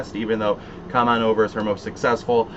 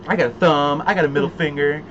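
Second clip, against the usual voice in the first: put-on southern accent; 5 semitones higher than usual. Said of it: "We could really quote the whole movie".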